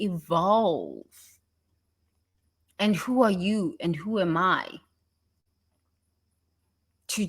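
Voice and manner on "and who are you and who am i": ordinary delivery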